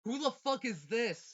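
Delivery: loud, slow, high disbelief; loud outburst. Real, not acted. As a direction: shout it in disbelief. Reading a rival's message aloud.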